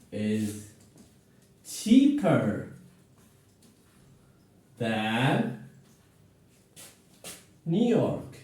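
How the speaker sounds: slow, spaced-out model sentence. Shown as a demonstration. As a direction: lay each word out slowly for the class. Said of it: "let me model some examples here".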